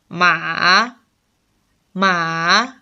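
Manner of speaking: "ma" sinking low, then turning up; third, dipping tone. Shown as a demonstration. Teacher links four tones together, now the dipping "ma". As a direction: make a long V with "ma". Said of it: "these four tones linked together".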